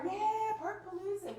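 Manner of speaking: high, bright-voiced cheer of Park Palooza; excited cheer. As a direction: hype up the kids, cheering. Mom cheering in the car.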